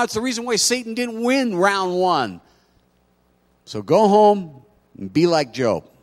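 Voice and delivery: high, loud fervent preaching; preaching at full force. Acted. Pastor presses the choice of faith on the congregation, preaching hard. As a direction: drive it home to the congregation.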